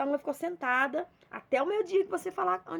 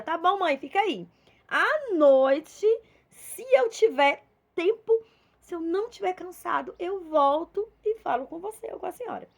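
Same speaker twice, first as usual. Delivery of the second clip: higher, louder, offhand promise to come back later; dismissive brush-off; 5 semitones higher, 6 dB louder than usual. Retold or imitated. Busy child putting off mother on the sofa, breezy dismissive tone. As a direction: brush her off with an easy excuse.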